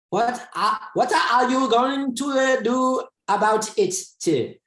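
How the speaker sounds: mock Chinese-accented student asking a question; student imitation. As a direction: play a hesitant Chinese learner stumbling through English. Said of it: "And the student's like".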